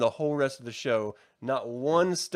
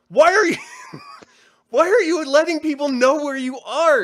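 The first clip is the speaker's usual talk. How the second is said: real exasperated yell, much higher and louder; 11 semitones higher, 9 dB louder than usual. Real, not acted. Podcaster yelling about a dumb sniper choice. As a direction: yell at the characters in frustration. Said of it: "I don't know why I'm yelling".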